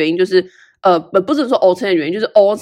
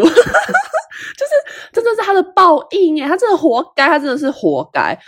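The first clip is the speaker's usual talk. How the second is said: laughing high through the words; real gleeful laughter; gloating laugh; 9 semitones higher than usual. Real, not acted. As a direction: relish her comeuppance while laughing. Laughing gloating over enemy.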